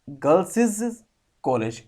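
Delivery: put-on गर्ल्स said as "girl-siz"; doubled s. Shown as a demonstration. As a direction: draw out the extra "siz" on गर्ल्स. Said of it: "दो बार एस की sound आती है".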